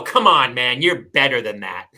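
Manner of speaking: loud retold scolding, dropping low on the end; mock scold a litterer at full volume; flipped out scolding. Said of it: "I flipped out".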